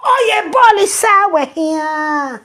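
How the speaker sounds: high, slow, exaggerated fake surprise; mock gasp. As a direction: gasp and drawl it out to mock her. Mocking the woman's claim.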